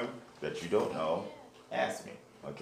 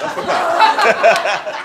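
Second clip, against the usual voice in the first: laughing; 15 dB louder, much slower than usual. Real, not acted. Teacher laughs mid-drill.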